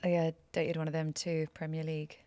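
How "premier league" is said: ordinary delivery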